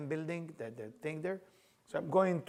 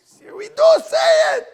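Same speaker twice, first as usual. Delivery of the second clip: slow, very high, full-volume shout; retold screaming; 20 dB louder, slower than usual. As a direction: act out screaming back with all your force. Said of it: "screaming at each other".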